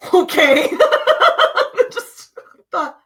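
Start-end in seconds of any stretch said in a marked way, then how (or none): 0.1-1.9 high, loud laugh breaking out; real laugh, high and loud; real burst of laughter. Real, not acted. Reacting to grandma's demon remark.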